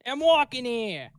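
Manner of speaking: loud, drawn-out New York holler; high, slow New York street shout; New York accent. Shown as a demonstration. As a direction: stretch it out like an irate New Yorker. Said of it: "You got one?".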